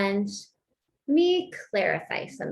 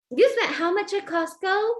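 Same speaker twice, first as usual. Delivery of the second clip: mock outrage; 9 semitones higher, 4 dB louder than usual. Repeats the acted accusation.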